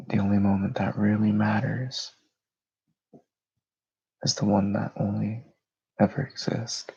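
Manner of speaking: unhurried meditation voice with long pauses; guided meditation. Real, not acted. Host leading listeners through breathing and grounding, meditative delivery. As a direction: guide them into calm, phrase by phrase.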